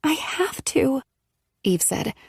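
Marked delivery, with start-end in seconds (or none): 0.0-1.0 slow, soft whispered; sorrowful whisper. A narrator's reading. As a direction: breathe it out in sorrow. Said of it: "her voice filled with sorrow".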